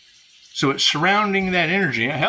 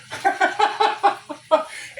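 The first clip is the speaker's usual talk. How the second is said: long, high-pitched cackling laugh; real laughter; 14 semitones higher than usual. Real, not acted. Laughs at her own story.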